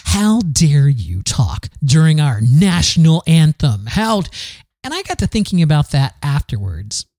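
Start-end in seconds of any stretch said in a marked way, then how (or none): 0.0-4.3 slow, indignant scolding; imitating an affronted bystander, word by word; mock outrage. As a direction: scold like a scandalised patriot. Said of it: "gave me the glare of death".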